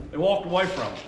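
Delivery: ordinary talk, nothing marked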